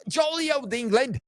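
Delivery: lightly put-on British, badly done; bad British accent. Podcast host mocking his British guest, faint British accent. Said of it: "I know that wasn't a good British accent either".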